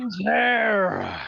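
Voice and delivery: loud high shout. Real, not acted. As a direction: call it out loudly. Shouted exclamation.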